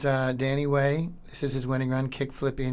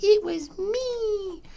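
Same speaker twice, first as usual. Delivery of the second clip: much higher, slow, silly squeak; goofy high voice; 4 dB louder, much slower than usual. Retold or imitated. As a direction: play it as a cartoonishly innocent reply. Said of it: "And I'm like".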